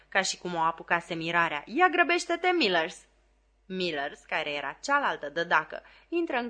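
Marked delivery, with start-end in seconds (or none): 1.7-3.0 loud urgent call, pitched higher; shouting. A narrator's reading. Flopson calls to the other nanny to hurry, shouting. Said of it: "strigă Flopson".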